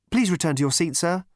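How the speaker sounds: high, polite robotic stewardess; prim android hostess voice. A narrator's reading.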